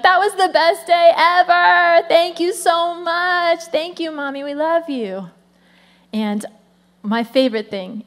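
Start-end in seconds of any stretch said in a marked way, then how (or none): 0.0-5.3 put-on grateful kids. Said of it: "And they're like".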